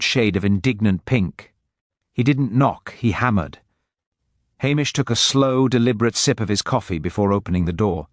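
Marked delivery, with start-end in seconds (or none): none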